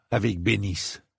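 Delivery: ordinary delivery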